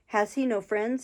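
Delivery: ordinary delivery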